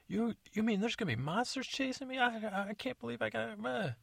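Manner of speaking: high panicky squeak; high shrill comic stepmother; squeaky stepmother. Acted. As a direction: whine it like a startled stepmother. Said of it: "stepmother's like".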